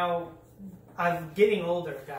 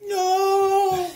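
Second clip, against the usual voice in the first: shocked yell; 13 semitones higher, 10 dB louder than usual. Real, not acted. Vacuum part breaks.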